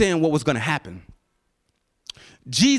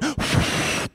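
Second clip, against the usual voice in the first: long blown-out breath; acted airy exhale; breath of life. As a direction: breathe out hard, as if giving life. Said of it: "breathed the breath of life".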